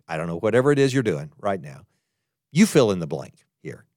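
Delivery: ordinary delivery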